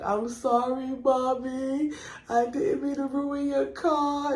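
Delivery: high, slow whiny crying voice; crying apology. Retold or imitated. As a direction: sob it like a sorry child. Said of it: "So now I'm crying like".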